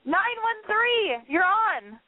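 high, slow announcer call-out; radio host announcing. Acted. Host calls up the next caller on air, high and slow.

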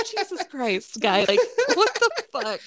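high laughing what the fuck; laughing disbelief. Retold or imitated. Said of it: "And they're like".

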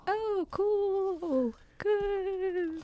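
tiny, very high, soft voice; strained falsetto politeness; mock uneasy approval. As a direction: squeak out polite praise, drawn out. Uneasy reply to sculpture gift.

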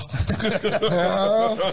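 a loud burst of laughter; hearty real laughter. Real, not acted. Laughing at the story.

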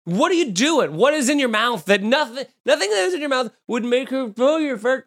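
high-pitched incredulous questioning; mock exasperation. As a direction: act baffled by someone's absurd mouthful. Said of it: "and you're like".

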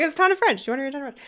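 high, excited mock voice of the fans; playful impression of the audience chiming in; imitating eager listeners. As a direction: pipe up like listeners shouting the answer back. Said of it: "I can hear them being like".

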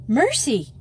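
acted gasp of surprise; startled gasp. A narrator's reading. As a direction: exclaim in sudden shock. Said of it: "gasped Madge".

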